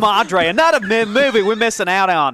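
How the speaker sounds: put-on Mexican Australian accent. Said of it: "a Mexican Australian accent?".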